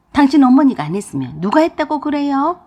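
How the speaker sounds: a wife shouting back at her husband; indignant yell. Acted. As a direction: throw it back at him, loud and high. Mother-in-law yells back at husband.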